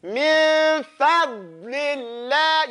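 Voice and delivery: very slow "Min fadlillah", dad pressed and held; chanted, stretched "Min fadlillah"; full dad. Shown as a demonstration. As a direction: hold n and d long in "Min fadlillah". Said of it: "Okay, the whole end".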